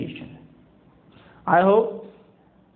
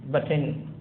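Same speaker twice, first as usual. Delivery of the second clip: ordinary delivery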